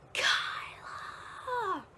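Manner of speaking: high, far-off mock yell of a name; faint echo of a shouted name; distant echoing call. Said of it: "all I can hear is her voice through that phone in an echo".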